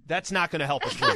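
words broken up by laughter; laughing. Real, not acted. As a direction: say it while cracking up. Laughing through a joke line.

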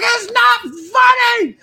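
high, slow mock shriek; drawn-out screeched imitation; scolding grandmother. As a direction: yell it like an indignant grandma, hammed up. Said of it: "she's like".

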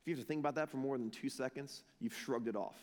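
low, soft, quick conviction; hushed, sobering aside to the room; quiet challenge. Real, not acted. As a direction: drop it low and soft, letting it sink in. Soft reflective first take of challenge.

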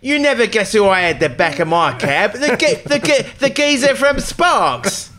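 cockney taxi driver; cockney cabbie accent. Retold or imitated. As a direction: play the old cab driver, hammed up. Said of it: "Those English accents".

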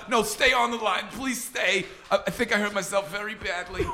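high, pleading comic voice; Saul. Retold or imitated. Hurt caller begging them to stay on line, high pleading. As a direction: plead in feeble mock pain. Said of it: "pretends to fall down the stairs".

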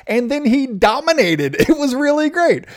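excited. Real, not acted. Excited about the dominant start.

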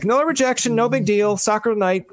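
high-pitched, breezy self-talk; breezy brush-off. Acted. Job seeker brushing off another rejection, breezy.

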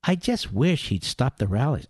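low, soft mock lament; mock wishful complainer. Host mimicking critics of the rallies, soft lament. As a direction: murmur it low as a feigned plea.

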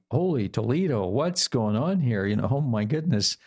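imitating the stunned kids; ambushed surprise. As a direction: stretch it out in sing-song amazement. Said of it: "they were all a little bit ambushed".

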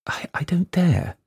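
hushed, trembling whisper; frightened whisper. A narrator's reading. Girl afraid of her father, whispering. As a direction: whisper in fear.

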